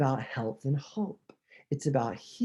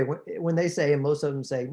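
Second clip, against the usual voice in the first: ordinary talk, nothing marked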